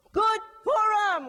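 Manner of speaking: bellowed holiday greeting; loud lively greeting. Acted. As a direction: ring it out full of life. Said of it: "he sounds loud and alive".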